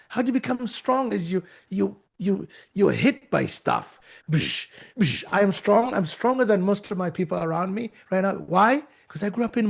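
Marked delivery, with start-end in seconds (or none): 4.3-5.2 two quick mouth-made hit sounds; imitated impact noises. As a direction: mimic blows landing. Imitates being hit by stuff.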